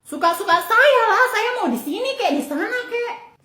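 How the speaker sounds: defiant sass. Acted. Market mother sasses the TV reporter, high and defiant. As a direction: brush off the nosy reporter.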